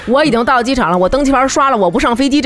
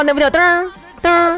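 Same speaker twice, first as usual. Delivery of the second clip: high mimicked beep; scanner beep; 10 semitones higher than usual. Shown as a demonstration. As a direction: chirp it like a scanner gate. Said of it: "你就噔儿".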